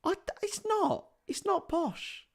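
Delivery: very high, softened protest; squeaky denial. Real, not acted. Streamer told they sound posh, squeaky denial.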